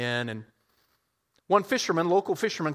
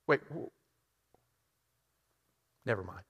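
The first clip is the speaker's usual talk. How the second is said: dry quiet realisation; 5 semitones lower, 7 dB quieter than usual. Acted. Fisherman realises the irony.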